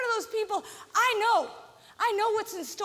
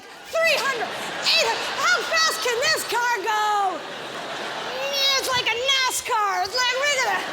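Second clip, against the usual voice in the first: higher, louder, slow wonderstruck; awed gawking; 4 semitones higher, 5 dB louder, slower than usual. Retold or imitated. Comedian as drivers marvelling at speeds going past the pulled-over car. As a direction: stretch it out in gawking amazement.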